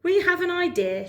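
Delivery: high cheerful frog. Acted. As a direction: frog with a plan. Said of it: "said Frog".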